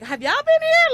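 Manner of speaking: high, loud disbelieving question; incredulous shout. Retold or imitated. Asking people who warned her off travel, loud incredulous shout. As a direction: throw the question back in disbelief.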